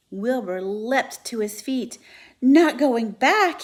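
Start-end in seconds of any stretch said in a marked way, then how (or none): 2.4-3.6 slow, loud, higher disbelief; shocked cry. Acted. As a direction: cry out in alarm. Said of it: "he cried".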